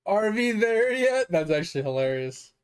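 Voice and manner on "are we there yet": high, slow whining question; whiny kid. Pretend. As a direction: drawl it like a bored kid. Reading a game title aloud.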